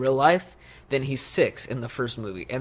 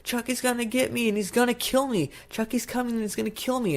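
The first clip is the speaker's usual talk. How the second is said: retold panicky warning in a child's pitch; imitated yelling boy; 11 semitones higher than usual. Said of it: "he's yelling out the window".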